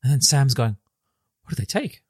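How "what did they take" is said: higher-pitched, softly surprised question; a touch of puzzled surprise. Retold or imitated. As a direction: ask it in mild, quiet surprise. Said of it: "Like, he's surprised".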